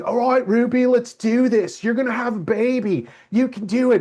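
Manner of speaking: put-on high, upbeat SoCal surfer-girl coaching; SoCal surfer accent. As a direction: cheer her on in a bright SoCal surfer accent. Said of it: "she's doing this with her surfer accent".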